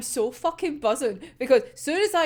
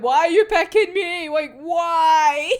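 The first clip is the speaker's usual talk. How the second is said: higher, louder mock panic; panicking; 5 semitones higher, 6 dB louder than usual. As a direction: wail the question in hammed-up panic. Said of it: "absolutely panicking".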